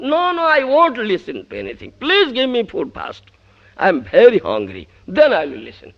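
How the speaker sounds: loud, high, impatient demand; insistent. Acted. Krishna refusing to listen until fed. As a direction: brush her off, hungry and insistent.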